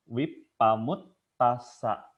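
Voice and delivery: Wipamut tasa drawn out slowly, each syllable separate; slow deliberate Pali syllables; slow clear syllables. Shown as a demonstration. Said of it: "kita harus clear ngucapinnya".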